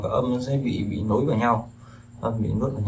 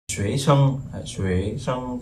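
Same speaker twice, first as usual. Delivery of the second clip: "xuế sân" with the first syllable XUẾ stronger than sân; stress on first syllable; 2 semitones higher, much slower than usual. Shown as a demonstration. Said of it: "xuế nó lại lớn hơn".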